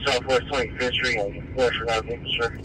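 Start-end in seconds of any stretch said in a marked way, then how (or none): none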